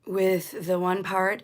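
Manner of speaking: ordinary talk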